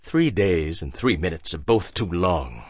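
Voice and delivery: quiet, falsely casual threat; forced casual. A narrator's reading. Hagbard holding the gun on Harry, forced casual. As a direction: try to sound offhand. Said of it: "trying to sound casual".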